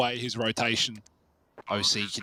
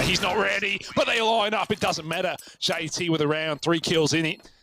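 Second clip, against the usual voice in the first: high-pitched climax call; animated esports caster at the payoff; excited play-by-play; 5 semitones higher than usual. Real, not acted. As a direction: call the winning kills with a surge. Live play-by-play, round won.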